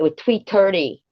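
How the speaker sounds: put-on high, loud send-up of a phony rolled-R accent; mocked phony accent. Streamer ridiculing a rival's phony rolled-R accent, mocking accent. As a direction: exaggerate a fake accent to ridicule it. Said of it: "Rolling all your R's and shit".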